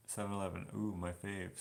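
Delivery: ordinary talk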